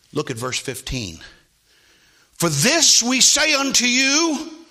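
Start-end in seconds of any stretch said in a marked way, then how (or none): 2.3-4.7 loud, high, drawn-out proclamation; full-force preaching. Real, not acted.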